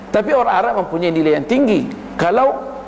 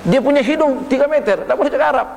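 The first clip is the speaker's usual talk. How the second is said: ordinary talk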